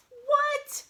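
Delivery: very high startled yelp; shocked squeak. Acted. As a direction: squeak out in disbelief. Sidekick shocked by plan.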